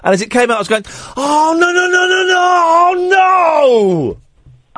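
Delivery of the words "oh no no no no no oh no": high wailing, long-drawn "no"; hammed-up wail of horror, falling at the end; mock despair. Host reliving notes coming out of the cash machine, mock despair.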